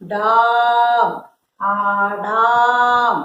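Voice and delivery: slow, stretched daam in ഡാം and ആടാം; drawn-out long vowel. Shown as a demonstration. Teacher spelling for pupils, ഡാം and ആടാം drawn out. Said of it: "നീട്ടി പറയും".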